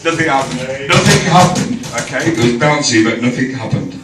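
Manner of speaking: reciting rhyming verse; poem recital. Acted. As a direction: read it like a poem.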